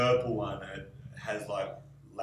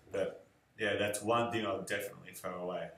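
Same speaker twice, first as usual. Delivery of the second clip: normal talking voice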